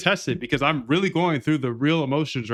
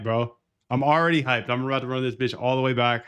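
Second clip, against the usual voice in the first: ordinary talk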